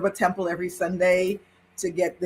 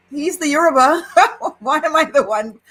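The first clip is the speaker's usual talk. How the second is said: high, loud, laughing disbelief; half-laughing outrage, louder and higher; exasperated protest; 9 semitones higher, 6 dB louder than usual. Retold or imitated. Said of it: "I'm like".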